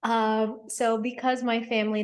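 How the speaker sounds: ordinary talk, nothing marked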